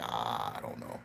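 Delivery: low, soft, trailing mumble; clueless mumble. Retold or imitated. Speaker mimicking a modern Nigerian with no answer, mumbling low and soft.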